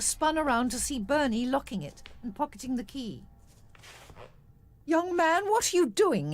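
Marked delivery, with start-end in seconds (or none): 4.8-6.3 high, loud indignant demand. A narrator's reading. Doctor confronts Bernie over the locked gate, loud demand. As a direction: snap it out as a challenge. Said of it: "he demanded".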